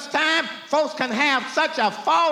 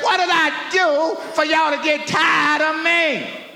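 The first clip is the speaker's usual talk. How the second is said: higher, louder hurt shout; God's shouted question; 5 semitones higher, 4 dB louder than usual. Acted. God demanding answers of his people, shouting. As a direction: call out the grievance with full force. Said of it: "He said".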